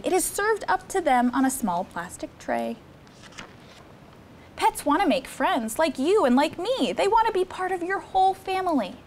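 put-on lively read-aloud for children; storybook reading. Counselor reading Cat in the Hat book to campers, lively storytime. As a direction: read the rhymes to the kids with bounce.

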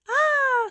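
high, loud scream; women scream. Acted. Townswomen screaming as the giant comes. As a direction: shriek in terror. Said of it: "Women screamed".

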